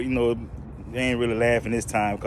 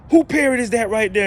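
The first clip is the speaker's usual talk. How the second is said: higher, louder angry demand; mad demand; 12 semitones higher, 8 dB louder than usual. Retold or imitated. Angry lady demanding answers from the manager. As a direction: confront the manager. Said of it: "The lady was mad".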